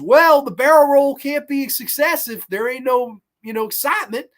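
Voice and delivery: loud, high showman's justification; mock-folksy booming retort, easing off at the end; hammed-up mayor. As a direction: play a gleeful mayor selling the stunt. Mayor voiced answering the victim.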